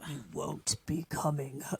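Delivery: soft, muffled trailer voice; milk-mouth voice. Retold or imitated. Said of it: "hella milk mouth".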